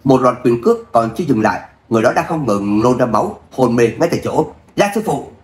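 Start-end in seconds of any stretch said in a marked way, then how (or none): 4.8-5.3 high, urgent cry for the master; anxious shout. Acted. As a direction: call out in alarm. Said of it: "sốt ruột hét lên".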